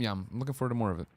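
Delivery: normal talking voice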